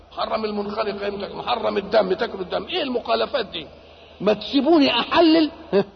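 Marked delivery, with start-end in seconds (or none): 4.2-5.8 loud, higher-pitched protest; indignant rebuke. Acted. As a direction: rebuke them in a raised, higher voice. Voicing God rebuking lawmakers.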